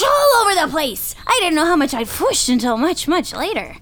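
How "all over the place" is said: usual speaking voice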